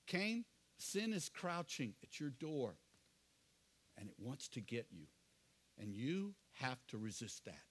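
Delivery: a hint of a father warning gently; fatherly counsel. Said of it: "Do you hear that fatherly counsel?".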